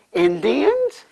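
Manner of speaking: loud, high astonished echo. Real, not acted. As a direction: repeat it in disbelief. Astonished echo of Violet's word.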